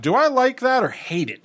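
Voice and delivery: high puzzled self-quote. Retold or imitated. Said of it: "am like".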